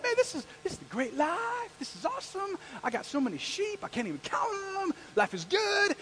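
high-pitched, carefree boasting; pleased as can be, words stretched high; delighted. Acted. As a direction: revel in how good life is. Voices contented Moses with sheep.

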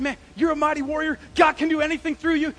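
loud, high acted pep talk; fired-up encouragement. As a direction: hype them up at full volume. Acting out hyping someone up.

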